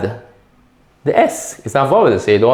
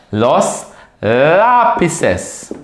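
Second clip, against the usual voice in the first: very slow "lápices" for learners; slow teacher's model of the word; drawn-out plural; 3 semitones higher, much slower than usual. Shown as a demonstration. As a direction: stretch out "los lápices" word by word.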